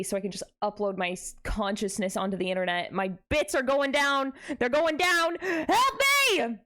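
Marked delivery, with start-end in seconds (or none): 2.9-6.6 hammed-up cry for help, louder and climbing higher; mock panic. Streamer to chat as her connection drops, hammed-up panic. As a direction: wail like the stream is sinking, half joking.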